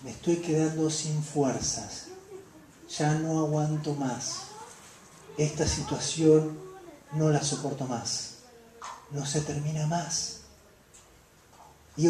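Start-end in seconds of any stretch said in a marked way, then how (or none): 0.0-10.4 weary lament. Acted. Exhausted believer voiced in sermon.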